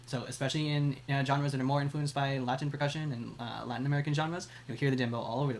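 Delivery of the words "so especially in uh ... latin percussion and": normal talking voice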